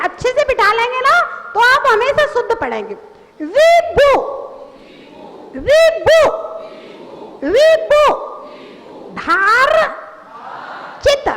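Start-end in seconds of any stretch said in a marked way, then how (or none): 3.4-8.0 "विभु" firmly, a clear v then b; measured teacher's model of "विभु", each sound separate; careful drilled pronunciation. Shown as a demonstration. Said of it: "एक एक अक्षर का उच्चारण कीजिए". 9.1-11.3 "धार", a long pause, then "चित"; piece by piece. Shown as a demonstration. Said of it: "एक एक अक्षर का उच्चारण कीजिए".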